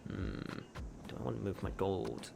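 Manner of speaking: normal talking voice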